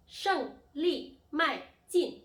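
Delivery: 胜利迈进 loud and high, each syllable falling sharply; emphatic falling-tone drill on 胜利迈进; fourth-tone demo. Shown as a demonstration. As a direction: punch out 胜利迈进 slowly, one falling syllable at a time. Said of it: "now the fourth tone".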